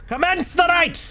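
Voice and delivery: high, ringing proclamation; booming call. Acted. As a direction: call the rite to begin like a herald. Game announcer shouts rite start.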